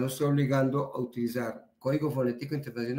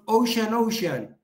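emphatic call sign; 8 semitones higher, 6 dB louder, slower than usual. Shown as a demonstration. Repeating a call sign over the radio.

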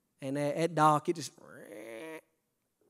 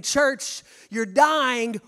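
urgent call; 4 semitones higher, 6 dB louder, much slower than usual. Acted. Jesus voiced urging the church.

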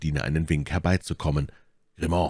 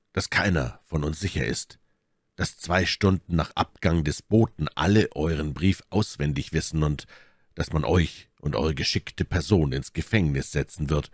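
ordinary delivery

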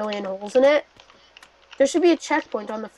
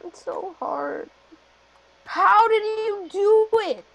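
whiny; 7 semitones higher, slower than usual. Real, not acted. Whining after failing.

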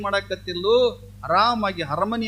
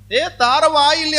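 a loud, raised call to bring it here; sharp, raised call; 4 semitones higher, 6 dB louder than usual. Acted. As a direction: call out to the woman across the house. Saint calls for the grinding stone.

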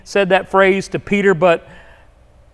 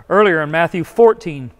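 ordinary talk, nothing marked

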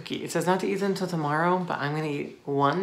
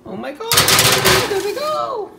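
very high excited squeak; excited squeal; 7 dB louder than usual. Real, not acted. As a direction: shriek up high with glee. Cookies rising in oven.

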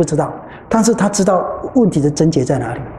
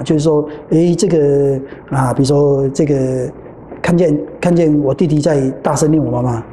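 usual speaking voice

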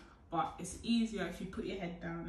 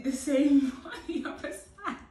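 higher, louder, breaking into laughter; laughing; 6 semitones higher, 10 dB louder than usual. Real, not acted. Vlogger moving to the other side, laughing. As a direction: crack up partway through the line.

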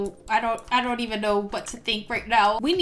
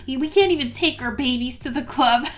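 normal talking voice

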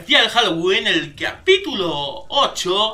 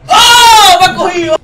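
high, loud frightened yelp; panicked cry of being caught; real scared scream; 10 semitones higher, 14 dB louder than usual. Real, not acted. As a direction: scream as the monster grabs you. Jump scare, caught in game.

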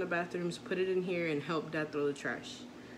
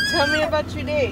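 much higher and louder, eager to hear about his day; excited question; 10 semitones higher, 13 dB louder than usual. Real, not acted. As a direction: call it out high and loud, delighted. Asks son about school.

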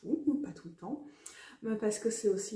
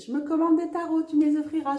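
higher and louder, bubbling with mischief; gleeful mock order; 7 semitones higher, 11 dB louder, faster than usual. Telling her partner what to buy, gleefully loud. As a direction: announce it like a cheeky demand. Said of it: "j'ai fait".

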